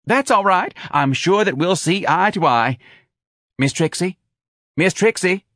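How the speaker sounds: high, loud, reassuring chirp; eager office manager. A narrator's reading. Manager reassuring applicant and calling old employee, eager.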